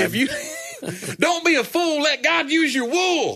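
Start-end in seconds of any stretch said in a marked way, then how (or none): 0.3-1.1 high laugh. Real, not acted. Bursts out laughing. 1.2-3.3 high, slow, hammed-up preacher sing-song; mock preacher rhyme. Mock sermon rhyme, laughing.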